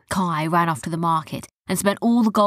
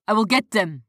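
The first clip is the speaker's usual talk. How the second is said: lightly voiced guard, changed timbre; a hint of a pirate henchman; 3 semitones higher than usual. A narrator's reading. Pirate guards search the room, lightly voiced threat. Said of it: "said the parrot".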